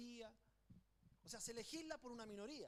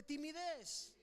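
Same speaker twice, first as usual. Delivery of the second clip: higher-pitched, emphatic prompt to the congregation; strong, emphatic call-out; 4 semitones higher, much slower than usual. Shown as a demonstration. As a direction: call it out for the crowd to echo. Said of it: "Diga, dígalo fuerte". (dicho con fuerza, para que lo repitan)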